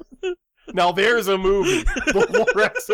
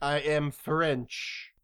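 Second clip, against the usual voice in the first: low, soft, halting fake French; badly faked French accent; 4 semitones lower, 7 dB quieter, much slower than usual. Actor auditioning for a French overdub, half-hearted French accent. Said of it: "a little bit of sample of your French accent".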